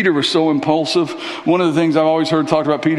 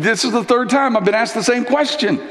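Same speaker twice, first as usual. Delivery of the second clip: frustrated Peter; 7 semitones higher than usual. Retold or imitated. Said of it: "he's getting a little bit frustrated".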